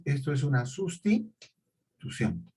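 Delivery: ordinary talk, nothing marked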